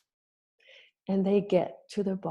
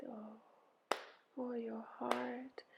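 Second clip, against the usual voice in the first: slow, whispery sigh of sympathy; soft echoed sigh; 5 semitones higher, 14 dB quieter, much slower than usual. A narrator's reading. Helpers echo Ereshkigal's heartache, hushed slow sigh. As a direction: sigh it back very gently. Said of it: "They sighed".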